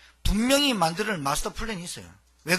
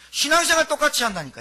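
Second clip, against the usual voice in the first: raised, higher preaching push; 7 semitones higher, 6 dB louder than usual. Acted. Preacher pressing the congregation, raised voice. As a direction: drive the point home to the pews.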